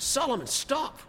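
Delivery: dad's sharp, high-pitched command, acted out; mock telling-off from the front seat; father scolding. Father scolding son in car.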